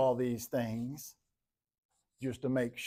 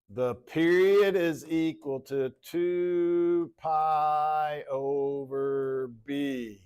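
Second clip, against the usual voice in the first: drawn out; 3 semitones higher, 3 dB louder, much slower than usual. Real, not acted. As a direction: draw each word out as if writing it. Spells out formula while writing it.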